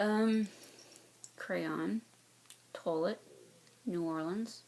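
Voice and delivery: ordinary talk, nothing marked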